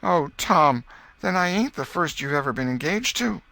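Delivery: put-on a girl realizing she's not the first; hurt girl Becky. A narrator's reading.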